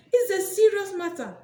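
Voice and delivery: high, loud declaration; loud exclaim. Real, not acted. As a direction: proclaim it over the laughter. Exclaims loudly mid-sermon.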